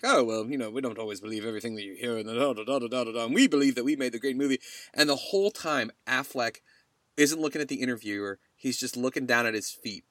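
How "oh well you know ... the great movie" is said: lightly imitated British accent, skimming quickly through; a hint of British, rattling off a rehearsed answer; Henry Cavill. Podcaster mimicking the star answering an interviewer, light British accent. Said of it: "like, British, and he's like".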